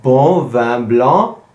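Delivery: bon vin blanc slow with nasal vowels; slow French phrase drill. Shown as a demonstration.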